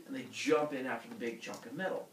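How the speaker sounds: ordinary delivery, nothing marked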